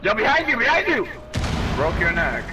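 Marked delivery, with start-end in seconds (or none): 0.0-1.1 high urgent warning; warning shout. Real, not acted. Warning teammate.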